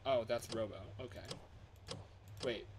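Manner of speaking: usual speaking voice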